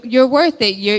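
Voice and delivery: usual speaking voice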